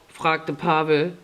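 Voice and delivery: usual speaking voice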